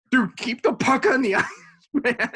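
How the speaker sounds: high-pitched mock complaint; half-laughing, high mock scolding; scowling rebuke. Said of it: "like, a scowl".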